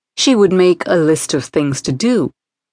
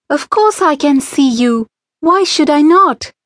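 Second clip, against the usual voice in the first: put-on girl asking; 8 semitones higher than usual. A narrator's reading. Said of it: "asked Jayashree".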